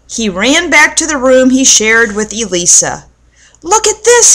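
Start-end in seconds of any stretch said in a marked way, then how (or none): 3.6-4.3 brandish it and yell; shouting. A narrator's reading. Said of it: "he shouted".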